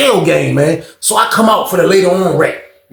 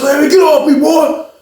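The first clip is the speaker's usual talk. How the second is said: mock shove-off; 11 semitones higher, slower than usual. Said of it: "Sean punched him in the chest".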